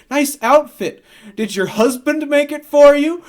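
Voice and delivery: high, loud put-on jeer; taunting. Spidey mocking Bonesaw from the cage, taunting. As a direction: call it out as a cheeky taunt.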